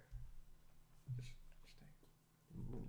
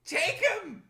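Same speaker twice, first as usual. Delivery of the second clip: exasperated shout; 13 semitones higher, 8 dB louder, much slower than usual. Acted. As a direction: yell it, fed up and high.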